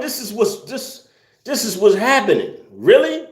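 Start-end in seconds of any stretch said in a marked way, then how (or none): 2.7-3.3 high, rising disbelief; incredulous. Real, not acted. Streamer can't believe food makes people murderous, incredulous. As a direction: pitch it way up, astonished.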